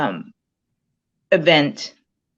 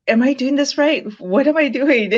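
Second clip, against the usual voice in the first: high, louder anxious questions; worried self-doubt; 9 semitones higher, 5 dB louder than usual. Retold or imitated. Texting the coach again, unsure of her progress, high worried voice. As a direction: fret out loud over whether it's right. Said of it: "I'm like".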